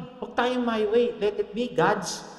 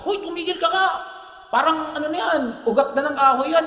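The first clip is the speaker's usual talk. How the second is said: mock angry scold; 6 semitones higher than usual. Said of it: "Nagalit yung asawa".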